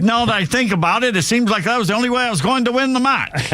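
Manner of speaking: put-on high-pitched, off-target Australian accent; botched Australian accent. Host quoting tennis player Gadecki, botched Australian accent. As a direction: commit to a bad Australian accent. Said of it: "I have no idea what accent that was".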